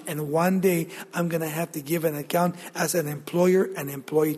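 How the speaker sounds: soft, low, intimate preaching; hushed earnest appeal. Real, not acted. Preacher confiding his wish to be faithful to God, hushed. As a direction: drop to a quiet, confiding register. Solemn personal appeal in sermon.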